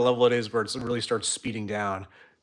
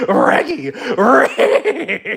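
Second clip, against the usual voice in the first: high, very loud cartoon dog; hammed-up doggy yelping; Scooby-Doo; 12 semitones higher, 11 dB louder, much slower than usual. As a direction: call your pal like a cartoon dog, rolling the R. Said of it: "Can you do the Scooby-Doo voice".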